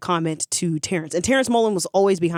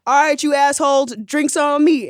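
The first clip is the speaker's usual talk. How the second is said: higher and louder, a big entrance; loud swagger; 6 semitones higher, 4 dB louder than usual. Retold or imitated. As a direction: call it out to the whole bar. Kicks door open, buys drinks.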